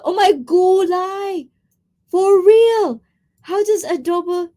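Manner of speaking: shocked exclamation. Real, not acted. Shock at eating lizard.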